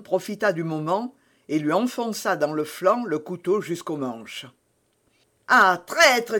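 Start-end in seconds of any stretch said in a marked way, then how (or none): 5.5-6.4 high, loud accusing cry; anguished cry. A narrator's reading. Buckingham just stabbed by Felton, crying out. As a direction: cry out at the betrayal. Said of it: "cria Buckingham".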